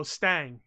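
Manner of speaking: "Stang" drawled with the a of a Southern twang. Shown as a demonstration. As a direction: say "Stang" with a Southern twang, the i of Sting turned to a flat a. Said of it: "So he says Stang".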